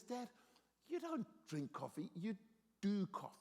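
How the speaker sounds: measured mock-wise correction; son schooling dad. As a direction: imitate a son patiently setting Dad straight. Son instructing dad, acted out.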